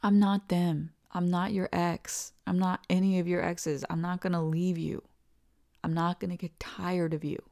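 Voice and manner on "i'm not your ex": ordinary delivery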